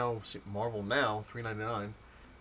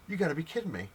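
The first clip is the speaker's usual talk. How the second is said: disbelieving quote; 7 semitones higher, 3 dB louder than usual. Retold or imitated. Disbelief at the comic's price.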